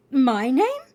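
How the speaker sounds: suddenly interested and disbelieving; surprised question. Acted.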